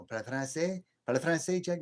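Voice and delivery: a light, hammed-up French accent, rising like a question; mock French. Asking Jackknife if he speaks French, mock French accent. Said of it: "he could have that French accent on there".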